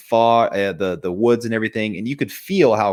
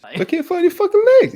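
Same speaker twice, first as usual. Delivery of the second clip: imitating a much higher, louder broken cry; Rambo's anguished yell; 5 dB louder than usual. Rambo breaking down in front of Troutman, mock anguished yell. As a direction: wail it like a soldier falling apart. Quoting Rambo's climactic line.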